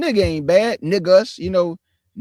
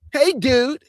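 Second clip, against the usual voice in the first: high, louder put-on "dude" greeting; 7 semitones higher, 5 dB louder than usual. Shown as a demonstration.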